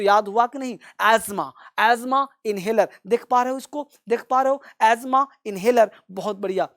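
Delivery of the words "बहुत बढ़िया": normal talking voice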